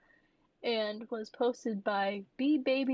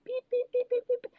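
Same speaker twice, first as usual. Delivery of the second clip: very high dialling beeps; squeaky mock dial tones; imitated phone beeps; 14 semitones higher, 3 dB louder than usual. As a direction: beep out a keypad in a high voice.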